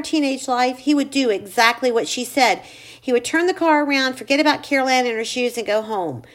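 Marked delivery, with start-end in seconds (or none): none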